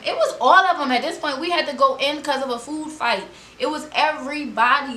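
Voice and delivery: building to the food fight; rising excited storytelling. Real, not acted.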